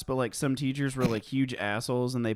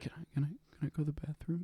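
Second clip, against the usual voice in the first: very soft, hesitant pupil imitation; timid mumbled request; 3 semitones higher, 10 dB quieter, faster than usual. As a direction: ask under your breath, faltering. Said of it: "Can you speak up?".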